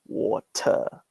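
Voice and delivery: "water" with a hard, attacked T and no R; British water. Shown as a demonstration. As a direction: hit the T in "water" crisply. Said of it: "they, like, attack the T".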